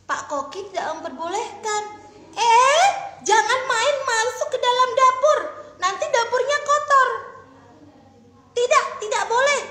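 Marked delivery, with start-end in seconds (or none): 2.3-9.6 much louder, higher scolding cook; stern chef shooing a kid out. Acted. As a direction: tell the child off hard. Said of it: "kata Pak Koki".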